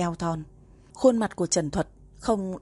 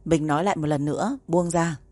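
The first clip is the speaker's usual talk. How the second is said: plain reading voice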